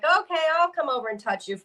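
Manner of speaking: high, quick, light retelling; retold casual offer. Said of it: "I was like".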